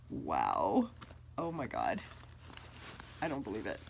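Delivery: low, hushed disbelief; stunned. Real, not acted. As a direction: breathe it out in shock. Stunned by the bag.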